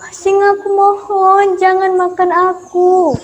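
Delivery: high, slow whimpering plea; crying mouse. Acted. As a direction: play the mouse as a weeping child. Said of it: "Lalu dia pun menangis".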